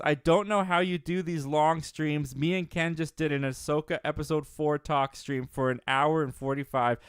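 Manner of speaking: ordinary talk, nothing marked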